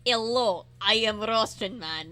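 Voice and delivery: hammed-up stock Russian accent; mock Russian, broad and cartoonish. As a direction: play a cartoon Russian man. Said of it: "like, the cartoonish".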